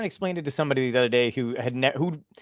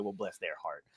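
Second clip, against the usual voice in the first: low, soft southern put-down; veiled contempt; 9 semitones lower, 14 dB quieter than usual. Retold or imitated. Mall Santa to parents he kind of hates, veiled contempt. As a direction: imitate a much lower, hushed, falsely kind aside. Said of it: "he would kinda hate the parents".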